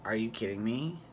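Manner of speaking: mock-unimpressed Bethany; a hint of Bethany brushing her off; sassy Bethany. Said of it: "Bethany was like".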